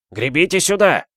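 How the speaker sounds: high, loud shout across water; yelling. A narrator's reading. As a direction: holler to rowers from the shore. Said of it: "орал хроникёр". (громкий высокий крик=)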